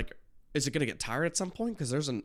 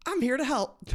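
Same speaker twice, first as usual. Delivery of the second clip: loud, very high character voice; comic high-pitched announcement; mock helper voice; 6 dB louder than usual. Voices the dude in the picture.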